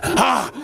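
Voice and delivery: high yelp; a father yanking back his hand; sharp cry of pain. Acted. The father crying out when bitten, high yelp.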